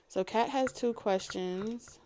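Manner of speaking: normal talking voice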